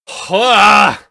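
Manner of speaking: loud, high groan of disgust; exasperated sigh. Real, not acted. Critic fed up with the writing, loud exasperated sigh. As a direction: let out a big exasperated huff. Venting anger at awful writing.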